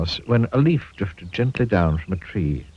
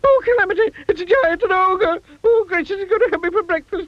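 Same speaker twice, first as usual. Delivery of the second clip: very high, fast, frantic wail; panicked little voice. A narrator's reading. Mister Jelly fearing the ogre, frantic high wail. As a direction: squeal about being eaten. Tiny man panics at giant.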